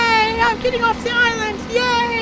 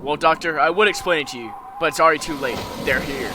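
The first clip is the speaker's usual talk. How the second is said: mysterious low voice. Acted. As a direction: speak low from the shadows. Stranger warns the Doctor.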